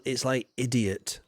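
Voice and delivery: usual speaking voice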